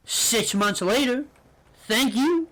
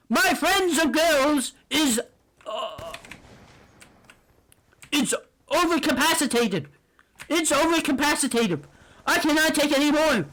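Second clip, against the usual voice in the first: higher, heated sarcastic rant; exasperated rant; 6 semitones higher than usual. Real, not acted. Vlogger ranting at the girl who friendzoned him, heated and sarcastic. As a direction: vent it all out, fed up and bitter.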